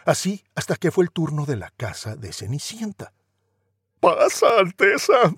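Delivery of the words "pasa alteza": higher, louder sugary "Pasa"; scheming sisters' welcome; wicked stepsisters. Acted. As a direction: coax him in with fake sweetness.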